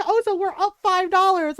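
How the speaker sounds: loud, high mock indignation; imitating an angry husband's sarcasm; sarcastic outrage. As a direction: throw it out loud and scornful. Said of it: "'cause Ron was like".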